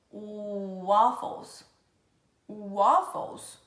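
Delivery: "waffles" with a long gliding "oo" onset; W sounded as U. Shown as a demonstration. Teacher showing W as U, drawn-out "waffles". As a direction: stretch "oo" into "waffles", slowly. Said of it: "tem um som de U".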